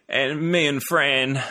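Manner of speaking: slow, drawn-out mimicry of a director's voice; put-on voice, stretched right out; Peter Jackson impression. Guest imitates a film director reminiscing, slow mimicry. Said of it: "Peter Jackson's voice, it would be".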